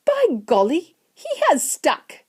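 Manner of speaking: put-on high-pitched boy voice; very high, astonished child; amazed Charlie. A narrator's reading. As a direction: marvel at it as a young boy.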